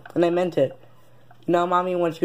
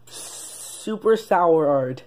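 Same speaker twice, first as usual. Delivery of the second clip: slow drawn-out name; much slower than usual. Acted. Kid inventing a fake name, dragged out slowly. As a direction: stretch each word out slowly.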